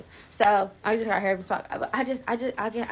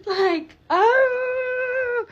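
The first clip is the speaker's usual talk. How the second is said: a high, loud laugh that cracks into a wail; laughing cry; 4 dB louder than usual. Real, not acted. Pharmacy delay again, to her viewers, laughing and crying. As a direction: laugh so hard at the bad luck it nearly turns to crying.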